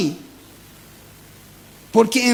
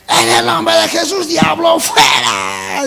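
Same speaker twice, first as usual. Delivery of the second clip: rebuking devil; 4 semitones higher, slower than usual. Retold or imitated.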